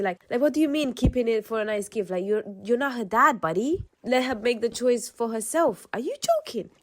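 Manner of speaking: exasperated rant rising to a high finish; pissed-off disbelief. Real, not acted. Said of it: "it just pissed me off".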